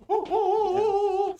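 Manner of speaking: high, loud "woo-woo" yelps; cartoon dog whooping. Imitating the little dog fleeing, high cartoon whoops. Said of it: "with its legs, like".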